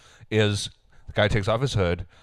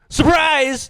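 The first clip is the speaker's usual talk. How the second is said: high gasped mock surprise; a gasp, then surprise!, way up; 6 dB louder than usual. Friends joking about a face, gasping surprise.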